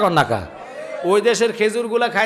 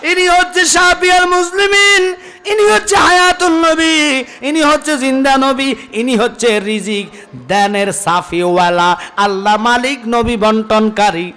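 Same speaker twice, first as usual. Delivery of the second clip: high, loud ringing proclamation, settling by the end; full-force preaching; 7 semitones higher, 6 dB louder than usual. Real, not acted. Preacher proclaiming the Prophet's titles, full-force preaching. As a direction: shout each title out to the crowd, then ease down.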